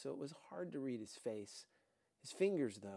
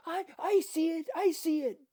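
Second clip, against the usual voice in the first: high, loud excited shout; 14 dB louder than usual. Acted. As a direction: yell it out like an eager kid. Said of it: "Dika shouted".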